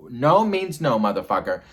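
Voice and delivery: loud, high, forceful refusal; punched, shouted warning; emphatic declaration. Real, not acted. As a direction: lay down the law at full volume. Emphatic declaration against the attacker.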